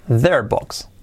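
quick, contracted and run together; brisk natural-speed model; said fast. Shown as a demonstration. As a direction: say it as one fluent chunk. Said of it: "Dicho rápido".

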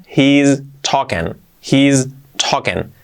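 slow "he's talking" with he and is run into one; "he's" as one long syllable, then "talking"; contracted form. Shown as a demonstration.

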